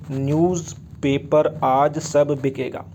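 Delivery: drawn out word by word; slow mnemonic. Shown as a demonstration. Chemistry teacher giving the class a memory trick for Group Fifteen, slow and spaced out.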